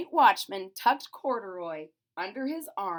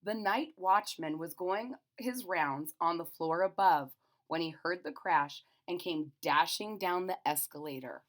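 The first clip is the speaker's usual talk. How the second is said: plain reading voice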